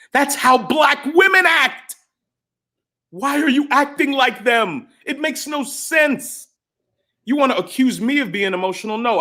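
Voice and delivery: exasperated outburst, stretching the words; real exasperation. Real, not acted. Exasperated rant at absent critics.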